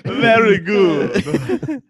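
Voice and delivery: slow hammed-up Greek accent; a mock Greek accent with a laugh. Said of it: "speak in a Greek accent".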